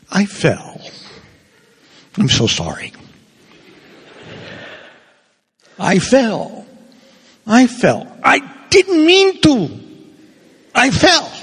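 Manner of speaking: high, pleading, apologetic voice; helpless confession. Shown as a demonstration. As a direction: beg forgiveness like you couldn't help it. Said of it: "why are we talking like that".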